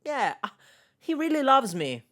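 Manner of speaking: high mock-gushing voice; mocking Lakeisha. Reacting streamer mocks the smitten woman, high and gushing. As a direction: gush like a smitten fool. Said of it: "she's listening to this phone call and being like".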